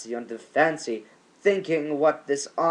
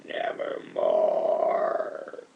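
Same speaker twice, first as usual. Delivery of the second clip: low, slow, breathy whispered croak; raven's reply; much slower than usual. Acted. Raven answering the poet with a low, slow whispered croak. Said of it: "Then the bird said".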